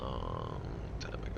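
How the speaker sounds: low, rumbling repeat of the phrase; deep voice. Shown as a demonstration. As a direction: drop into a deep voice. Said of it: "repeated in a deep put-on voice".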